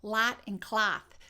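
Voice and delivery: "lot" and "cloth" with one same flat A vowel; slow mistaken Chicago "lot" and "cloth", vowels identical; botched Chicago accent. Shown as a demonstration. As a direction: make "cloth" rhyme with "lot", overdone. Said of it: "people mess up the Chicago accent".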